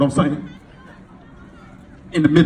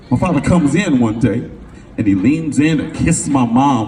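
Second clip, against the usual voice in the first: ordinary talk, nothing marked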